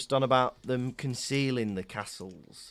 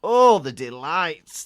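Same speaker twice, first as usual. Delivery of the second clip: loud, slow, hammed-up delight; mock ecstasy; 3 semitones higher, 11 dB louder, slower than usual. Gloating over a maroon dress robe in loud mock ecstasy. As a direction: relish it loudly, drawing it out. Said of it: "you're gonna be in ecstasy".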